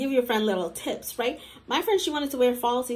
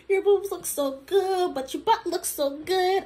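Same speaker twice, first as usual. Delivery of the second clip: higher, drawn-out mock gushing; imitated hype-up; 6 semitones higher than usual. Girls hyping each other up before going out, imitated gushing. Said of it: "hyping each other up".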